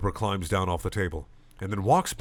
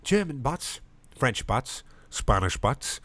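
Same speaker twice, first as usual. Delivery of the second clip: put-on Irish accent; 4 semitones higher, slower than usual. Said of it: "Purpur cries out".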